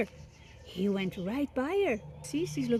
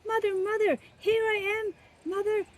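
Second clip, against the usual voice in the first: high, eager chick calling; excited baby bird; 9 semitones higher, faster than usual. Acted. Baby bird running up to the big thing, high and eager. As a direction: cry out joyfully to mother.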